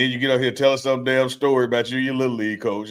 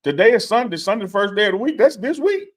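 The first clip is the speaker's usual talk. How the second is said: real excitement sending the voice high; high, excited voice; 10 semitones higher than usual. Real, not acted. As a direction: insist excitedly, climbing to a squeak by the end. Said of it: "my voice got high 'cause I was excited".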